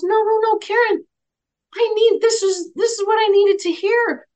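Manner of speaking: high, loud insistent no; emphatic denial. Retold or imitated. Grateful client stopping the medium's apology, high loud insistence. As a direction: cut him off eagerly.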